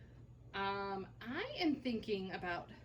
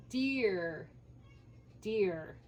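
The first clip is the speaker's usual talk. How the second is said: ordinary talk, nothing marked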